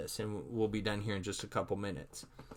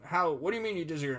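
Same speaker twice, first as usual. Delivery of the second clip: higher, fast indignant challenge; hammed-up indignant retort; mock confrontation; 6 semitones higher than usual. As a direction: fire it back at a colleague in mock outrage. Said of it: "coming at her".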